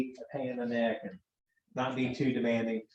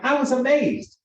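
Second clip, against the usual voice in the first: exasperated protest; 11 semitones higher, 6 dB louder, slower than usual. Retold or imitated. Defending his text to wife.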